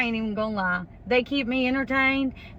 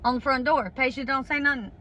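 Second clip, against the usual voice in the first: ordinary talk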